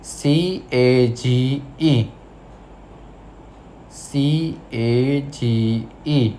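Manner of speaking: slow, letter by letter spelling. Shown as a demonstration. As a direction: spell each letter slowly for the class. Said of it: "So number first is".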